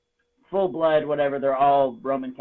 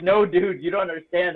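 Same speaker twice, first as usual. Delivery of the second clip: emphatic protest; 3 semitones higher, 6 dB louder than usual. Retold or imitated. Defending himself to his friends.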